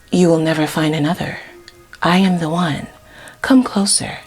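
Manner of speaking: soft, low-key seductive enchantress act; mock mesmerizing. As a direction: lure them in quietly, phrase by phrase. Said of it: "Look how mesmerizing this shit looks".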